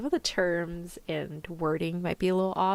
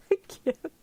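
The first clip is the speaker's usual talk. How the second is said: cracking up, voice pitched way up; laughing while talking; 8 semitones higher than usual. Real, not acted. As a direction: squeeze words out through helpless laughter. Cracking up at failed accent.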